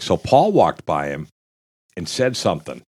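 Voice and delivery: normal talking voice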